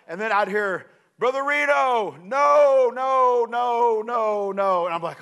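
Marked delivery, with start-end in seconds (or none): 1.2-4.8 distant shouted reply, higher; far-off shout. Retold or imitated. Stephen shouting back through the blizzard from far off. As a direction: call back from up the slope.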